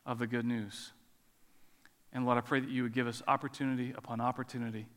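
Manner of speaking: low, unhurried congregational prayer. Real, not acted. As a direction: lead them in prayer, softening towards the amen.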